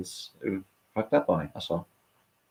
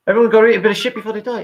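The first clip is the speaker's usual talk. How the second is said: louder, fast and much higher; loud fast declaration; 10 semitones higher, 5 dB louder, much faster than usual. Acted. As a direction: shout it out quickly. Repeats line with emphasis.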